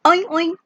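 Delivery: quick high pig oinks; pig oinking. Shown as a demonstration. Teacher giving the Indonesian pig sound, oinking high. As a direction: imitate a pig, two oinks. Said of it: "Babi bersuara".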